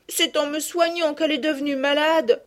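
a touch of tears in a high voice; mildly weeping girl; tearful. A narrator's reading. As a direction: say it close to crying, lightly. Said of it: "répétait-elle en pleurant".

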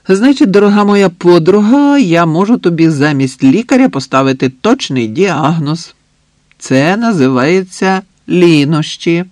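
loud, high, teasing pronouncement; playfully grand declaration to a friend; mock-solemn diagnosis. A narrator's reading. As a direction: announce it like a pompous verdict, slowing at the end.

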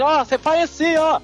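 yelling across the room. Retold or imitated. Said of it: "Ele gritava lá da frente".

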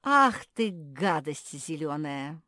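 drawn-out, fed-up name-calling; exasperated outburst. A narrator's reading. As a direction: lose patience and let it burst. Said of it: "не выдержала Жози".

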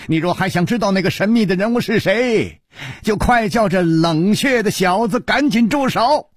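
high, pressing challenge; urgent demand. Acted. Challenger demands the fight stop. (高声急切地要求)

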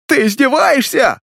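very high hysterical shout; hysterical yell. A narrator's reading. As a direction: scream it near breaking. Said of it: "проорал Тон". (истеричный крик)

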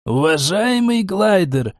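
high, slow, oily politeness; butter him up, stretching the address; unctuous. A narrator's reading. Утрамбовщик sweet-talks Глайдер, unctuous. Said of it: "елейным голосом произнёс утрамбовщик".

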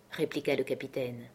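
usual speaking voice

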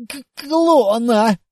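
strained, gasping through coughs; coughing. Acted. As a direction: choke out the word. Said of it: "С натугой, кашляя, хватая ртом воздух, просипел".

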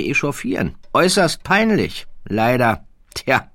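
a condescending royal drawling his words; haughty prince. Acted. The prince corrects the baron, high and lofty.

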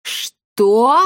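loud outraged roar, rising; bellowed disbelief. A narrator's reading. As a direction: roar the question. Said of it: "взревела Коптильда".

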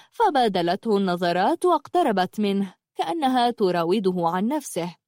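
ordinary delivery

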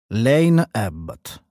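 ordinary delivery

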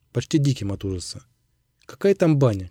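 ordinary delivery

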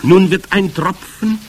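high, loud witch voice; commanding Grand High Witch. A narrator's reading. Witch leader starting to explain her recipe, commanding.

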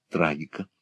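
plain reading voice

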